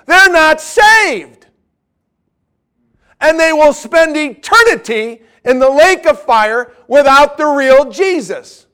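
urgent, emphatic warning at high volume; emotional preaching. Real, not acted. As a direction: preach it loud, stretching the key words. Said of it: "kind of emotional about this".

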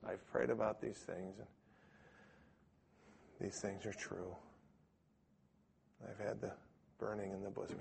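barely there, no conviction at all; weak testimony. Retold or imitated. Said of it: "just like this".

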